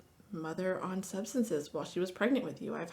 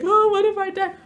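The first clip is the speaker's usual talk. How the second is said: much higher and louder hammed-up fright; mock panic; 13 semitones higher, 13 dB louder than usual. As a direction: parody the spiral of worry. Mock panic inside a quote.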